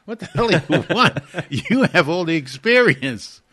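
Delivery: high, loud mock protest to Pete; retold exclamation. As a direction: throw it back at him with a laugh. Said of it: "I said".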